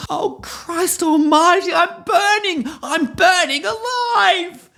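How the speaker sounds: very high, anguished screaming on fire; burning scream. Shown as a demonstration. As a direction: scream as flames engulf you. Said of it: "I'll just get into it".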